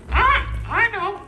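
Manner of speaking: very high squawky puppet voice; talking parrot. Acted. Parrot puppet naming his buddy, high squawky voice. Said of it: "you know who your buddy is".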